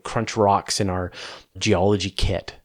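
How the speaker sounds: normal talking voice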